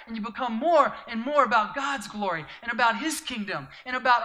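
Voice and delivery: loud, high, fervent preaching; full-force preaching. Acted. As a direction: preach it out to the crowd with fire.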